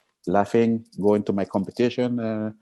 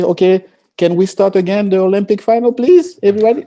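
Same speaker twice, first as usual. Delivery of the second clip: mock plea; 12 semitones higher, 8 dB louder than usual. Mock request to restart final.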